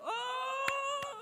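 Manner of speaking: sudden high startled oh; shy guy seeing the girl's look, high yelp; high flustered oh. Retold or imitated. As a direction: yelp it out, caught off guard. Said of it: "I was like".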